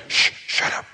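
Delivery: unvoiced shushing; hushing. Crowd silencing a blind man during service, hushing. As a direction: hush him under your breath.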